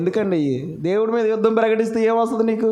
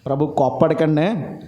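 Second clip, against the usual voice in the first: humble plea; 7 semitones lower than usual. Retold or imitated. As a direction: plead gently so as not to anger him. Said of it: "వెళ్ళి ఎలాగ అడుగుతున్నాడు చూశారు".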